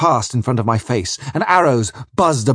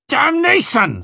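ぐっと高い声で怒りを爆発させる; 女を失って激昂する船長; roaring; slower than usual. Acted. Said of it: "Spring was roaring".

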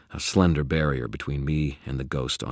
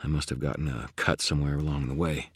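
usual speaking voice

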